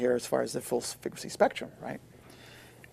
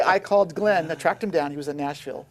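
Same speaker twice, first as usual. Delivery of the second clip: usual speaking voice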